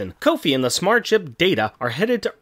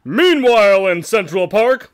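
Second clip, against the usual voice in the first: slow, louder narrator announcement; announcer voice; 3 semitones higher, 6 dB louder, slower than usual. Acted. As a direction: boom it like a cartoon narrator. Announcer scene transition.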